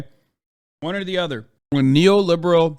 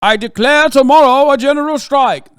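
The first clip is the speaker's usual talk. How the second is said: higher and louder, a pompous decree; mock proclamation; 10 semitones higher, 10 dB louder than usual. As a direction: proclaim it like a self-important leader, tongue in cheek.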